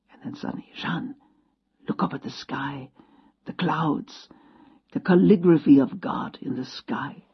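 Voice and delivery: hushed whisper, full of wonder. Retold or imitated. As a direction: whisper it like sharing a secret. Old man points at sky.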